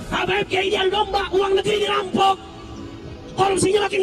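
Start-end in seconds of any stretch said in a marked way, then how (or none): none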